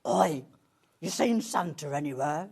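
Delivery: loud, low rough "Oi" like a thug creature; menacing creature voice. Acted. Voicing a scary reindeer toy to the host, loud menacing creature voice. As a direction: bark it like a scary toy monster. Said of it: "Where did that come from? Are you possessed?".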